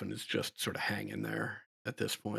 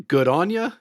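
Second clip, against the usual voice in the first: loud cheery veteran yell; hearty shout; 6 semitones higher, 12 dB louder than usual. Acted. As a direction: call it out like a grizzled soldier.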